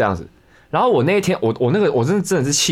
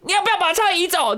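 much higher mock yell of a street stranger; crazy woman yelling. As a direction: squeal it way up like the unhinged lady scolding. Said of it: "他说".

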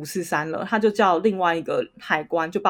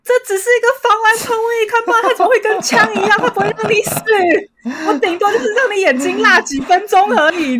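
high, loud, fast indignant disbelief; incredulous outburst; 13 semitones higher, 6 dB louder than usual. Retold or imitated. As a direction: blurt it out in shocked protest, much higher, laughing at the end. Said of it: "我就想说".

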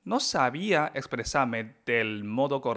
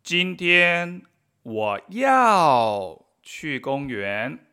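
slow, loud, careful Chinese examples; slow model sentences; 2 semitones higher, 6 dB louder, much slower than usual. Shown as a demonstration. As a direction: spell each example out slowly. Model sentences read slowly. (ejemplos leídos despacio y en voz alta)

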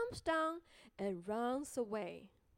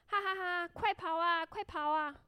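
high, louder teasing call; taunting shout; 5 semitones higher, 4 dB louder than usual. Acted. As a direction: laugh and yell it playfully. Quotes gingerbread man's taunt.

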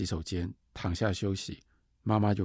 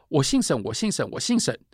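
put-on louder and higher as an angry girl; angry insistence; 6 semitones higher, 4 dB louder than usual. Angry girl insists on her surname to the nanny. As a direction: insist hotly on 我姓沈 three times. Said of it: "小女孩就很生气".

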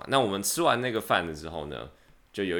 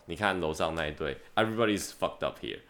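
usual speaking voice

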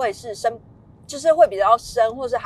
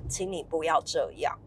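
soft, low, steady voice; quiet, lowered, gently insistent; gentle but firm; 4 semitones lower, 8 dB quieter than usual. Shown as a demonstration. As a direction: tell a child calmly and firmly. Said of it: "用温柔且坚定的语气告诉他".

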